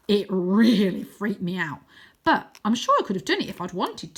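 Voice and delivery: put-on a touch of a boy covering his fright; boastful boy. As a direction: brush off the fear with bravado. Voices Wynne in story.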